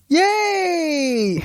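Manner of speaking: high mock cheer with a cry. Hawkeye gloating about his own show, mock cheer. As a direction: gloat with a hammed-up yay.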